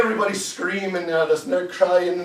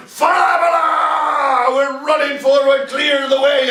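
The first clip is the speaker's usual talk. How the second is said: long high yell while charging; battle cry; 8 semitones higher, 6 dB louder, much slower than usual. Acted. Soldiers all charging forward, high loud battle cry. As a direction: cry it out over the field. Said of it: "we're crying".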